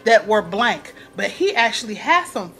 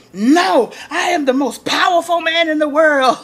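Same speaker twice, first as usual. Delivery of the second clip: louder, higher boast of power; proud declaration; 6 semitones higher, 4 dB louder than usual. Retold or imitated. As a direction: proclaim it like a hammed-up president. Cyrus declaring his power.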